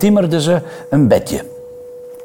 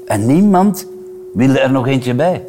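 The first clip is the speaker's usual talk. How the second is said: usual speaking voice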